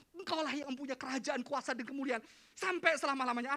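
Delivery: loud, high declaration to God; proclaimed prayer. Acted. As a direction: declare the prayer at full force. Prayer line proclaimed in preaching.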